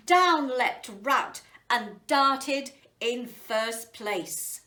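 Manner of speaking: usual speaking voice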